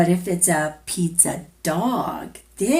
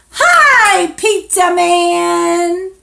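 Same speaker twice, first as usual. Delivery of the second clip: much higher, louder, slow greeting; excited call; 9 semitones higher, 10 dB louder, much slower than usual. Acted. To the child, finally greeting the pizza man, excited. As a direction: sing out hello to the pizza man.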